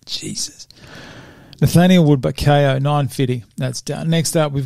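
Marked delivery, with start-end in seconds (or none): 0.1-0.7 whisper. Real, not acted. Exasperated at own stumbling.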